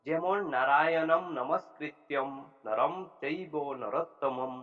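slow recited verse with every anusvara sounded as a clear m; reciting Sanskrit verse. Shown as a demonstration. Teacher reciting a Gita verse to show anusvara, slow chant. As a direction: chant নারায়ণম নমস্কৃত্যম slowly, closing each word on m. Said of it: "ম এর মতো উচ্চারণ".

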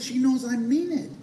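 usual speaking voice

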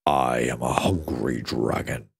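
very low, slow dragon growl; menacing low dragon; deep dragon voice. A narrator's reading. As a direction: rumble it like a huge hungry beast. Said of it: "said the dragon".

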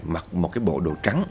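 plain reading voice

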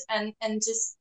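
ordinary talk, nothing marked

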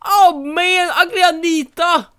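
accusing Anita of wrecking the house; angry accusation. Real, not acted. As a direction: confront the intruder. Accusing a creature named Anita.

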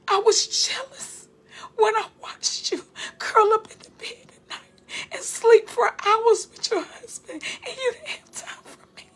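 high and crying through the words; tearful. Real, not acted. Preacher voicing God's jealous rebuke while weeping. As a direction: let the tears break the voice as you speak God's words.

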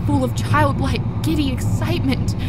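high, tense narration at the climax; heightened horror. A narrator's reading. Narrator sees the creature's eyes before darkness, heightened horror. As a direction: pitch it up with dread.

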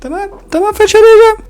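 a scolded little one giving in; shrill puppet reply; squeaky child voice. Acted. Toy agrees.

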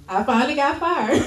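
laughing. Retold or imitated. Telling husband the news, laughing.

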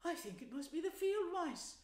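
mildly pleased, reminiscent storytelling voice; touch of pride. A narrator's reading. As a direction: let a little pride into the mole's recollection. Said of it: "with a touch of pride in his manner".

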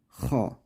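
ha, hırıltılı boğaz sesi; ha'yı gırtlaktan hırıltıyla; throaty ha. Shown as a demonstration. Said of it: "Ha'nın hırıltısı duyulacak". (hırıltılı ha)

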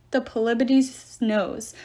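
usual speaking voice

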